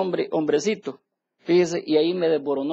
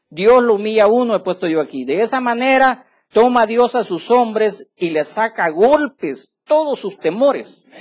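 fiery preaching; 4 semitones higher, 8 dB louder than usual. Acted. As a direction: preach every word out to the congregation. Preaching at full force.

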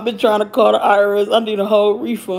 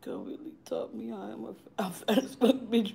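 hushed confession; 3 semitones lower, 15 dB quieter than usual. Real, not acted. Confessing weight gain.

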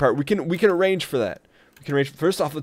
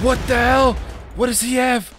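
much higher and louder alarmed outburst; shocked; 10 semitones higher, 4 dB louder than usual. Real, not acted. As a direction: jolt of alarm at the enemy. Enemy weapon shocks him.

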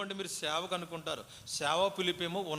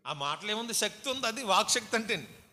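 usual speaking voice

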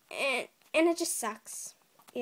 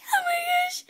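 very high breathless whisper-squeal; 11 dB louder than usual. Real, not acted. Squealing over first squishy.

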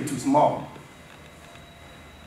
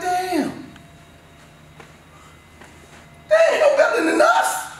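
mock amazed player; 4 dB louder, slower than usual. Voicing a player seeing other teams.

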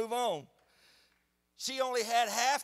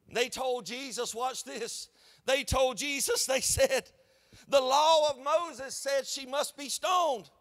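accusers' shout; 4 semitones higher, 5 dB louder, slower than usual. Acted. Preacher voicing accusers loudly.

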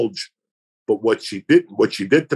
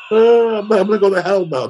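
higher, louder mock wail of guilt; a half-joking wail about going to hell; mock crying girl; 8 semitones higher, 3 dB louder than usual. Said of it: "They're crying".

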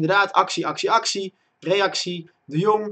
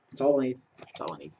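normal talking voice

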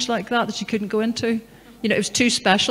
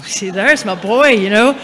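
delighted inner cry; 9 dB louder than usual. Retold or imitated. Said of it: "And I thought".

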